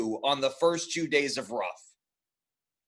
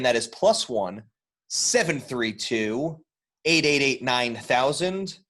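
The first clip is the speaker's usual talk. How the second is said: infomercial voice; 4 semitones lower than usual. Acted. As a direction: read the number like an ad. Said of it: "You sound like an infomercial".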